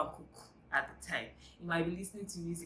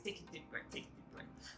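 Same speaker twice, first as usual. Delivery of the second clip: whispered, much softer repeat; mock whispered advice; 12 dB quieter than usual. Quoting what everybody says, in a soft whisper. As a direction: breathe the advice out in a hush. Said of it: "Everybody says".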